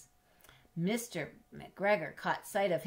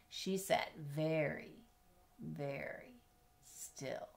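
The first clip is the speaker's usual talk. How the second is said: very slow, dropping softer to a hush on still; slow hushed build; 2 semitones lower, 7 dB quieter, much slower than usual. Acted. Reading to a child, hushing down for the cat. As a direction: draw out each word, fading to a whisper.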